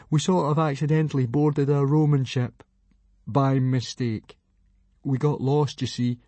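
small, timid confessing voice, pitched a bit up; hesitant, apologetic explanation; sheepish admission. A narrator's reading. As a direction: own up meekly to a superior. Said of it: "admitted Fishlegs".

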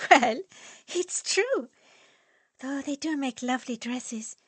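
put-on high, light young woman; breezy and unapologetic, high; Annalisa's voice. Annalisa to friends, breezily defending her remark.

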